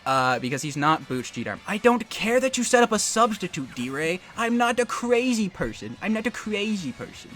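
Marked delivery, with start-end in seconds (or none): 1.7-7.3 high-pitched put-on protest; mock defiance. Player taunting his opponent over a substitute, mock defiance.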